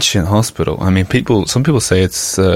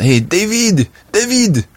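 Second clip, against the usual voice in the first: high-pitched yell for a colleague; imitated yell; 13 semitones higher, slower than usual. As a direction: call out the name in a much higher voice. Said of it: "he just yells out".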